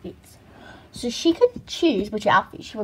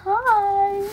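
doll voice; 10 semitones higher, 5 dB louder than usual. Pretend. Doll greets again.

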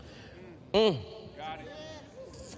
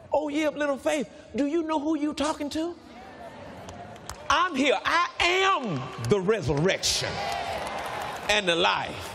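voicing Jesus; 5 semitones higher than usual. Preacher voicing Jesus to Martha.

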